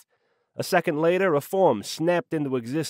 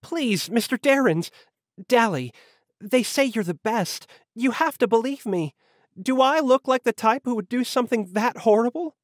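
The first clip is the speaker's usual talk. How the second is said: high-pitched pleading woman's voice; pleading damsel; 10 semitones higher than usual. A narrator's reading. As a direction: beg the detective to believe you, voice lifted high. Accused woman begs detective to believe her.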